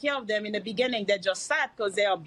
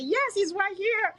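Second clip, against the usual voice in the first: excited; 11 semitones higher, slower than usual. Retold or imitated. Mom answers son excitedly.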